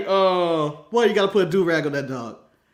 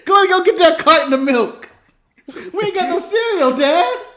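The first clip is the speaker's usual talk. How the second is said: put-on higher, laughing kid pleading with dad; mock kid voice; 9 semitones higher, 5 dB louder than usual. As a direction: whine it up like a kid at his dad.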